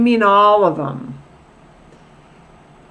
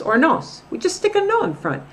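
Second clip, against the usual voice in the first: ordinary talk, nothing marked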